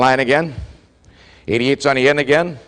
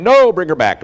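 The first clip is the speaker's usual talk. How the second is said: raised referee call, higher and louder; calling it back; 5 semitones higher, 4 dB louder than usual. Retold or imitated. Referee calling the play back, in a loud raised call.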